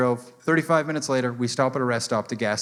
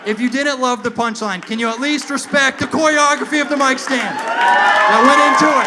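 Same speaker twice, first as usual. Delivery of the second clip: high, loud indignant yelling; pleading shout, building to the end; mock outburst; 10 semitones higher, 8 dB louder than usual. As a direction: yell at the crowd in mock outrage. Mock outburst at audience over punchline.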